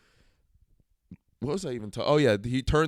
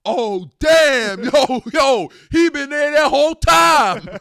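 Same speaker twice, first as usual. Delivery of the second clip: high, very loud hollering; yelled heckle; 13 dB louder, much slower than usual. Retold or imitated. Moviegoer behind him yelling at the screen, loud high holler. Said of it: "a guy behind me in the movie theater yelled".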